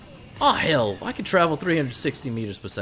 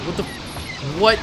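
usual speaking voice

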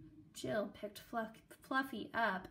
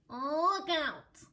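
louder and higher, a shouted warning; loud warning cry; 6 semitones higher, 9 dB louder than usual. A narrator's reading. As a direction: yell it as he charges. Fluffy charges the cats.

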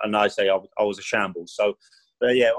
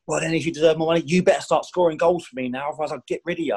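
higher, imitated threatening boss; retold angry threat; 6 semitones higher than usual. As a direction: threaten the striker as the angry manager. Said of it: "he just went into one of me".

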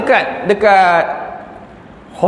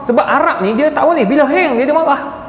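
usual speaking voice

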